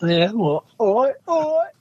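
hammy Cockney "all right" banter; mock Cockney. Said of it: "Cockney, dick eye, chavvy knacker".

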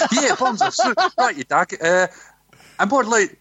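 high, hammed-up Australian twang; bad Australian accent. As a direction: overdo the Aussie bloke. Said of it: "channel your acting skills, as an Australian".

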